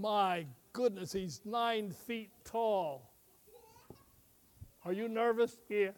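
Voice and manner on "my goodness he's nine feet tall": ordinary delivery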